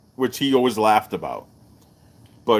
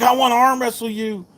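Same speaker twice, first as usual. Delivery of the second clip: much higher mock pushy drunk; hammed-up barroom challenge; pushy bar guy; 12 semitones higher than usual. Voicing bar guy again.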